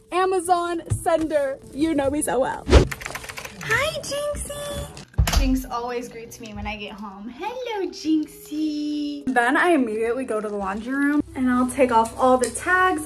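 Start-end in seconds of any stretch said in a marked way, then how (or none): 3.6-5.4 pet voice. Real, not acted. Greeting her pet. 7.3-9.2 pet voice. Real, not acted. Greeting her pet.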